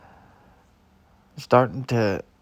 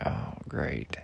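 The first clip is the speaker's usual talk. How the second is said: very soft, low aside; hushed, reluctant reply; retold grumble; 10 semitones lower, 11 dB quieter than usual. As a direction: say it under your breath, unenthused. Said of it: "I was like".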